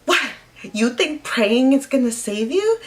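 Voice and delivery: imitating a scornful mom; mocking retold mom. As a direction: sneer at his praying. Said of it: "she's like".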